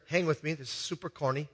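ordinary talk, nothing marked

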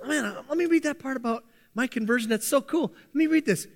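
imagined eager Paul. Pretend. Preacher imagines Paul rereading his conversion story, lightly acted eagerness. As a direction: play Paul keen to read about himself. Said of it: "Can you imagine the Apostle Paul".

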